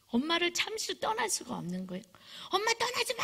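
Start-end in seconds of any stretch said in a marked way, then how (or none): 2.5-3.2 very high-pitched acted shriek; screaming child. Child begging mom not to leave, screaming. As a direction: cry out like a child in panic. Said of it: "악을 쓰고".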